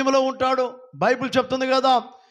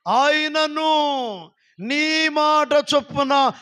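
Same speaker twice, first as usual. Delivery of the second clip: drawn-out, higher reading of the verse; slow scripture reading; 4 semitones higher, much slower than usual. Acted. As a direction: stretch each word like holy text. (నెమ్మదిగా వచనం చదవడం)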